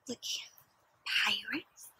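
hushed wondering whisper; secretive whisper to child. Acted. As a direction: breathe it in wonder. Whispered aside.

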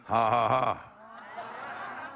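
slow hearty ha ha ha; a slow preacher's laugh; demo laugh. Shown as a demonstration. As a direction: show them how to laugh. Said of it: "Just practice laughing just for a minute".

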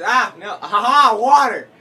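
high, very loud outburst; excited yelling. Real, not acted. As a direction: shout in gleeful alarm mid-game. Escaping attack in game.

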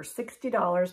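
ordinary delivery

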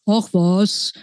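ordinary delivery, nothing marked

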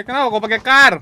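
shouting in frustration; loud complaint. Real, not acted. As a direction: yell it at the teammate. Teammate complains mid-match.

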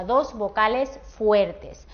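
ordinary talk, nothing marked

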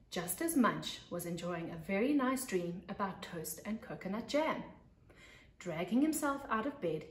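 put-on lively read-aloud voice for young kids; storybook narration. A narrator's reading. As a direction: tell it like a teacher to her class.

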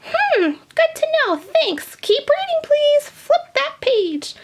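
put-on very high, squeaky old lady voice; Grandma puppet voice. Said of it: "All right, Grandma".

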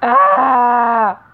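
sudden loud pained yelp when hit; a sharp cry as the bullet lands; startled yell. Real, not acted. Getting shot in the game, a sudden loud yelp.

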